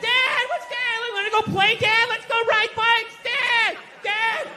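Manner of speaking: put-on high whiny kid voice calling out; kids calling dad. Kids pestering their dad to go ride bikes, high whiny calling. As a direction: nag like a small child wanting to play.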